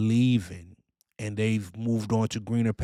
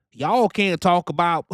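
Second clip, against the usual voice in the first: mock Southern accent, higher and louder; 7 semitones higher, 5 dB louder than usual. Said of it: "they use a Southern accent".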